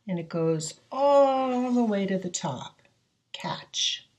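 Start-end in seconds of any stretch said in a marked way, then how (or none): 3.3-4.1 whispered word. Shown as a demonstration. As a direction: breathe the word softly to the class. Teacher whispers target word.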